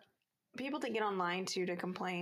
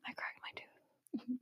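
soft unvoiced whisper; 8 dB quieter, much slower than usual. Real, not acted. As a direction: whisper it through a sore mouth. Says she cracked her tooth.

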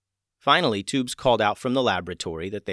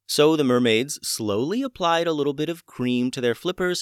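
ordinary delivery, nothing marked

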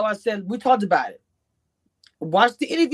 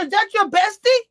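retold question; 11 semitones higher, 4 dB louder than usual. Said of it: "I even asked her".

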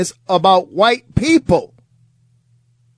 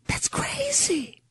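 whisper; 12 dB quieter, slower than usual. Real, not acted. Host reacts in shock.

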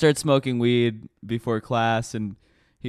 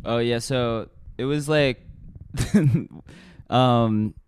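ordinary talk

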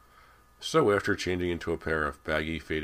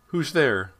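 much higher and louder, a sharp frightened challenge; shrill demand; 11 semitones higher, 11 dB louder than usual. A narrator's reading. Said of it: "she demanded shrilly".

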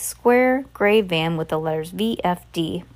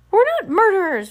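high, raised protest; frustrated cry; 11 semitones higher, 4 dB louder than usual. A narrator's reading. Violet protests the accusation to the shopkeeper, frustrated cry. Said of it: "cried in frustration".

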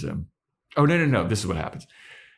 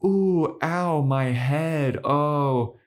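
a hammed-up ow, higher and drawn out; mock pained Spike; 8 semitones higher, much slower than usual. Spike faking a headache to fool Buffy, hammed-up. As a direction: fake being hurt, then reveal the trick. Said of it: "And then Spike goes".

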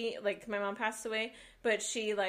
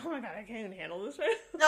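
overwhelmed, teary rush; much faster than usual. Retold or imitated. Overwhelmed, near tears.

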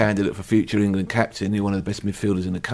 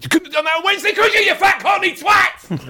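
much higher, louder furious yell at the TV; shouted insult; 5 dB louder than usual. Retold or imitated. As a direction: bellow it at the telly like a fan. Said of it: "Like that".

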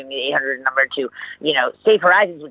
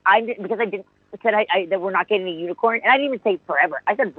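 ordinary delivery

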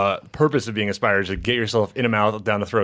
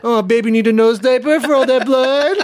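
put-on high, louder laughing baby voice; baby talk; 12 semitones higher, 5 dB louder than usual. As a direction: coo it through laughter.

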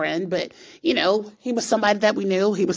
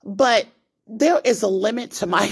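ordinary delivery

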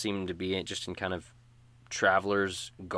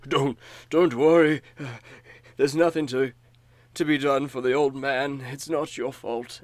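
gruff, raised man's voice; hardy old man; 6 semitones higher, 7 dB louder than usual. Acted. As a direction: speak up as a rugged old man. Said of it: "The hardy man kinda clutches himself and he says".

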